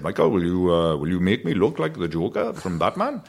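lightly voiced Welsh dad; Welsh accent. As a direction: imitate dad's Welsh lilt. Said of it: "'cause he's Welsh".

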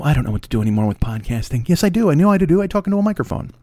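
ordinary talk

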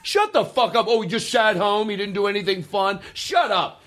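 high, emphatic mock protest; excited disbelief. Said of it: "We're like".

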